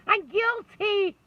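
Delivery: high, loud, slow hammed-up admission; mock confession. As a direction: throw up your hands and confess theatrically. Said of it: "confessing her mistake, mock".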